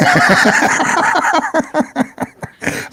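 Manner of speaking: very high squeaky giggle; squeaky giggly alien laugh. Real, not acted.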